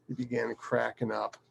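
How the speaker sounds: plain reading voice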